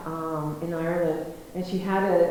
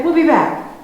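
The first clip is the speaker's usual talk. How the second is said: loud retort; 6 semitones higher, 9 dB louder than usual. Retold or imitated. Farmer reassures the girls.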